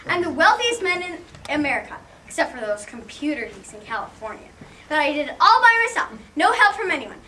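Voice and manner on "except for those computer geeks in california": lower, softer grudging aside; muttered aside. Acted. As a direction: drop it under your breath, reluctantly.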